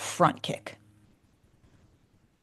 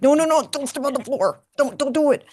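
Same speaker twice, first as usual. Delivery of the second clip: alarmed warning; 7 semitones higher than usual. Retold or imitated. Classmates warn her off floor.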